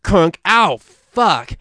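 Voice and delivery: retold pain cry. Retold biting bird shot.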